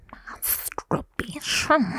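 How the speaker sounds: breathy unvoiced whisper, drawn out slow; a scandalised slow whisper. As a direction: mum whispering the shocking word, x0.4 slow. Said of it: "this is how she said it".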